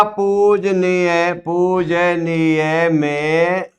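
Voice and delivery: पूजनीय stretched very slowly, syllable by syllable; drawn-out word. Shown as a demonstration. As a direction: say पूजनीय slowly so the class hears each sound. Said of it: "जैसे ऐसे दे देगा". (पूजनीय को धीरे-धीरे खींचकर बोलना)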